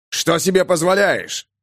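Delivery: indignant. Acted. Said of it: "прибавился гнев".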